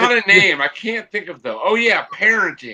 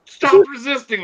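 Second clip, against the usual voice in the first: high-pitched mock police shout; imitated cop scream; 9 semitones higher than usual. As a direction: yell it like a cop, half in earnest. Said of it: "they're like cops screaming".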